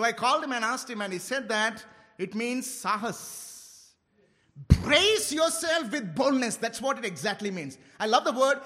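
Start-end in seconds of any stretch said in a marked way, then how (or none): 4.8-6.6 slow, loud, stirring proclamation; rousing preacher's declaration; fervent declaration. Acted. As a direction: declare it over the church. Declares meaning with music.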